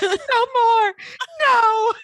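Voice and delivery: high, loud hammed-up scream of no; mock scream. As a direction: scream it like a soap heroine breaking. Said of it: "She screams".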